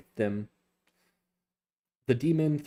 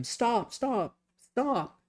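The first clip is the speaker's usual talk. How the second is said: much higher, squeaky repeated cry; a tiny creature panicking; small pet squeaking stop over and over; frantic little creature; 13 semitones higher, much slower than usual. Acted. Small creature Rue crying out.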